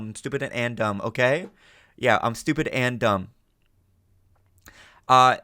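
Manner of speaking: usual speaking voice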